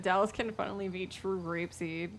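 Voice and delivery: ordinary delivery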